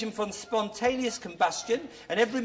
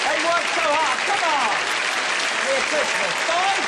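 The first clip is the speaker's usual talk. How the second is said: high, loud cheer for the crew; excited praise; 8 semitones higher, 6 dB louder than usual. Real, not acted. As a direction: hail the crew with big enthusiasm.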